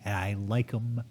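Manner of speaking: slow, low, quiet relish; mock solemn boast. Answering crude question as a man, slow mock-solemn boast.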